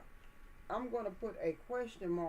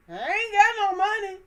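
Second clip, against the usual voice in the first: imitating [name]; 13 semitones higher, 15 dB louder than usual. Quoting a rapper's catchphrase to viewers in a loud high put-on voice. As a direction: belt it out like a funny catchphrase. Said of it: "Like [name] say".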